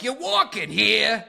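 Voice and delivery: put-on high, loud, slow New York accent, walkin' in heah. Trading accents with her cohost, a loud New York accent. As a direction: belt it out like a New Yorker, drawn out. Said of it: "And in New York".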